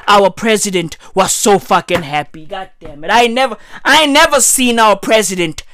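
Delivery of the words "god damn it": ordinary talk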